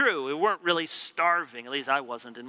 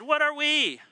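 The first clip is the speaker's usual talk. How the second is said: much higher, exasperated question; voicing Moses; 11 semitones higher, 3 dB louder than usual. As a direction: throw up your hands at the crowd. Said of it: "They said".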